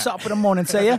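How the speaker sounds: high, loud cartoon British chirp; hammed-up British morning greeting; English accent. Co-host clowns as an English actor, exaggerated English accent. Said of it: "speaking in his English accent".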